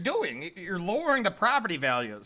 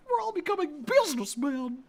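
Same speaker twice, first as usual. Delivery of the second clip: put-on child voice; 5 semitones higher than usual. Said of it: "Child number three".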